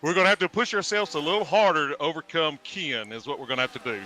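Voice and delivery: lightly voiced rallying coach; a hint of a team coach pep talk. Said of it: "He's putting on his coach voice".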